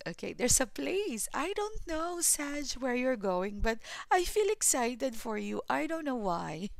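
real giddy high pitch; high excited voice. Real, not acted. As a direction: let the thrill push the voice high. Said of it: "My voice is high pitch".

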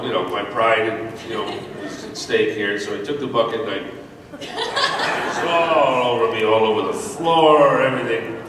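ordinary talk, nothing marked

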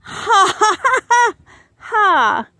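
hammed-up ha ha ha; mock laughter. Book laughing at the reader's banana. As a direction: laugh big at the joke.

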